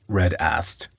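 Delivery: ordinary delivery, nothing marked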